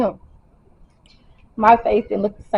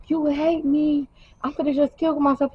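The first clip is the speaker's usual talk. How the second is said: imitating frantic boyfriend; 6 semitones higher, 3 dB quieter than usual. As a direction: play a distraught boyfriend in a raised pitch. Boyfriend acted, threatening in the car.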